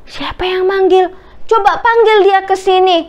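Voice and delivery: acted call to a crowd. A narrator's reading. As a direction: ask around and summon with authority. Jesus calls for the shouter.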